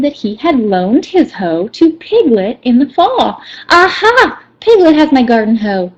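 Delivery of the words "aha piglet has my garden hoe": excited realization. Acted. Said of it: "said Rabbit".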